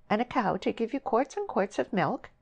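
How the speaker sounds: high, sugary question; sweetly. A narrator's reading. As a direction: ask her oh so sweetly. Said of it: "she asked sweetly".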